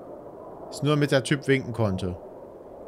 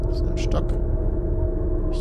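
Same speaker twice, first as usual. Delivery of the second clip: scared horror game streamer; faster than usual. Real, not acted. Quiet moment exploring horror game.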